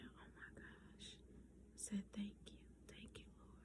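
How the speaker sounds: hushed awed thanks, barely voiced; breathless whisper. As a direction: whisper it, overwhelmed with gratitude. Overwhelmed quiet thanks after proposal.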